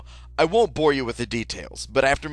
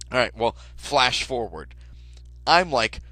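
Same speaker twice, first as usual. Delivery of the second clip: ordinary delivery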